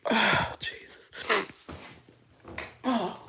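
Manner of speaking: breathy whispered sigh of relief; a real whisper to calm down. Real, not acted. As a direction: whisper it to yourself, slowly. Composing herself after crying.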